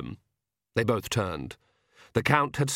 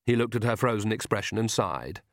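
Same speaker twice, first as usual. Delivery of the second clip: plain reading voice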